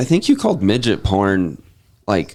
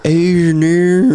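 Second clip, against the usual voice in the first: mock Australian; 8 semitones higher, 5 dB louder than usual. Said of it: "They're like".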